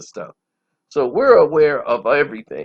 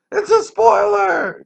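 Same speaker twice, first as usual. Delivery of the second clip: high, loud, drawn-out mock outcry; mock spoiler complainer; 7 dB louder than usual. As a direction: cry it out like an outraged whiner, hammed up. Mimics people crying spoiler.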